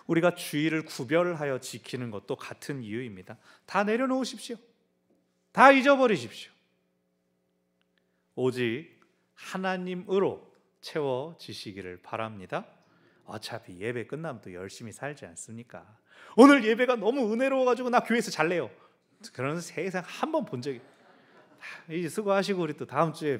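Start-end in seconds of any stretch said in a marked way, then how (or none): 3.6-6.5 higher and louder, a preaching cry; fervent urging. Real, not acted. 16.3-18.7 higher and louder mock congregant gush; hammed-up enthusiastic congregant; mock churchgoer. Imagined churchgoer quote.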